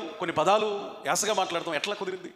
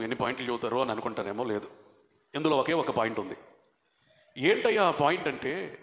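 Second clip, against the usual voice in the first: normal talking voice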